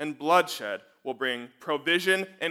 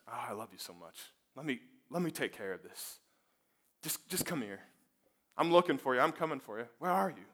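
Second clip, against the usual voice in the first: soft, much quieter loving voice; tender call; 3 semitones lower, 8 dB quieter than usual. Acted. God gently calls to hiding Adam and Eve, soft and loving. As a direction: coax them gently out of hiding.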